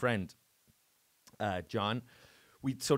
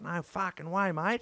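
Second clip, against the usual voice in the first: higher, broad Aussie impression; put-on Australian accent; 7 semitones higher than usual. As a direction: imitate an Australian host waving off payment. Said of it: "I'm doing this Australian accent".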